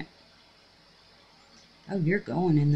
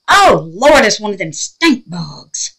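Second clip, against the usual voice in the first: loud, slower disgusted dread; stink bug dread; 2 semitones higher, 13 dB louder, slower than usual. Real, not acted. Telling viewers it's a stink bug, loud dread.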